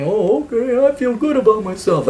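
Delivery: mock pleased voice, pitched high; gullible follower. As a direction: play the easily sold customer. Acts out duped follower of gurus.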